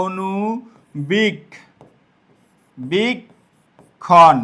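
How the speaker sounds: very slow, each syllable pulled apart. Shown as a demonstration. Teacher slowly breaking a word into syllables for students. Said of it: "Banglish করে লিখলে আমরা এরকম লিখব".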